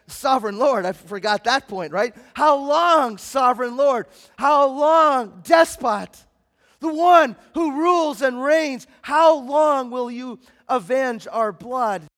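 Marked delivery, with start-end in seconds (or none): 2.3-12.0 loud, slow anguished plea; crying out. Acted. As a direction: hammer the cry up to the throne. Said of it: "they're crying out to the throne".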